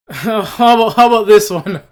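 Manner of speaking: laughing through the words; can't hold back a laugh. Real, not acted. Vlogger laughing at his own list, laughing. Laughing while reading next item.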